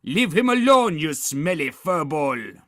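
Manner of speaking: high, loud outraged defence; defiant vampire. A narrator's reading. Vampire girl defending boy, loud defiance. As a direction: shout down the beast, starting high.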